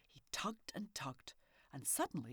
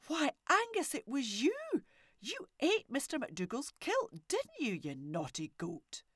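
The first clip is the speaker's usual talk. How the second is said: scolding wife; 9 semitones higher, 8 dB louder than usual. A narrator's reading.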